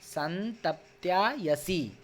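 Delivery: "संतप्त्यायसी" said slowly as one word, every syllable clear; slow careful reading. Shown as a demonstration. Teacher to students, reading a Sanskrit word slowly. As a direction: read "संतप्त्यायसी" slowly so students can copy. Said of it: "उच्चारण देख लें".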